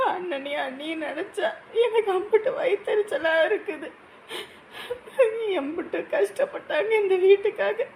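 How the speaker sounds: weep it out to your husband; a wife sobbing to her husband on a bus; crying. Acted.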